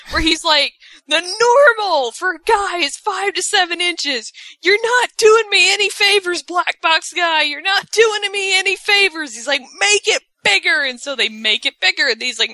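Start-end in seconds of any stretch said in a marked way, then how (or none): 1.1-9.3 high, hammed-up whiny complaint; mock bitching. 9.8-10.8 high, insistent retold order; mock demanding.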